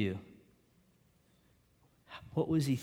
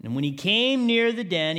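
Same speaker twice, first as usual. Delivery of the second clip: normal talking voice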